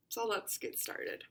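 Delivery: usual speaking voice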